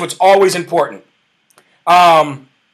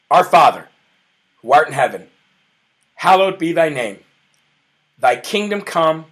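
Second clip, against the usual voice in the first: low, softer, reverent prayer recitation; Lord's Prayer; 3 semitones lower, 4 dB quieter than usual. Real, not acted. Host leading viewers in the Lord's Prayer, hushed reverent recitation.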